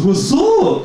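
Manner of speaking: very high, loud mock greeting; imitating Jose. Imitated work friend answering his greeting, high and loud. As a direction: squeal it back as the buddy's greeting. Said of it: "that's how he talks".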